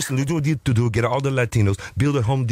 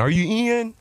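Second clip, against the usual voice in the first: high-voiced question; 10 semitones higher, slower than usual. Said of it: "I was saying".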